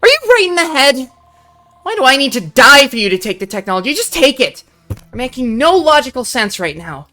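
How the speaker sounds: loud, high, disbelieving shouting at the betrayer; outraged shouting. Acted. Betrayed friend shouting at her, loud and outraged. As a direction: yell it at her like she's lost her mind.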